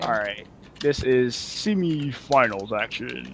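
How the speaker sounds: slow, low and soft, devoid of hype; unenthusiastic announcement. Real, not acted. Streamer announcing the semifinals to the audience, unenthusiastic. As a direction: announce it with drained enthusiasm. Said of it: "I hear the enthusiasm".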